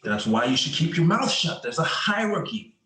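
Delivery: heated reprimand. Acted. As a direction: put your partner in their place, voice climbing. Partners quarrel in patrol car.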